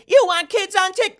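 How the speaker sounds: mock shrill interruption, very high and loud; Nikki Haley. Host imitates a candidate interrupting, shrill mock yell. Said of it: "she would raise her voice when interrupting".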